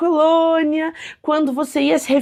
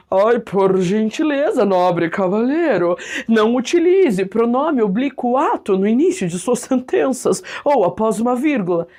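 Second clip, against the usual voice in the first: mock formal lecturing; 3 semitones lower than usual. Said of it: "Eu vou dizer pra ele assim".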